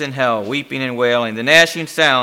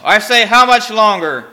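raised, desperate repeat of the question; insistent demand; 8 semitones higher, 7 dB louder than usual. A narrator's reading. Damned man pressing the silent demon, insistent. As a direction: press hard for an answer.

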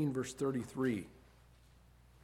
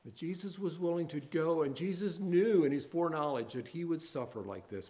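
ordinary delivery

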